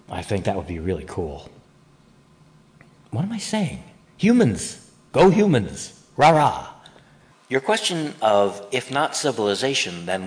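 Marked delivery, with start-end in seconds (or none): 3.1-3.8 mock surprise. Mock self-correction, pitch jump. 4.2-6.7 loud, high mock cheer.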